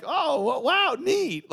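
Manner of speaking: high-pitched put-on wonder; mock wowed friend. Naive friend impressed by the mobster, mock wonder.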